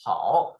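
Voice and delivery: teacher's model "hao", down and up; third tone. Shown as a demonstration. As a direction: say "hao" with a full dipping third tone. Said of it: "hao in third tone".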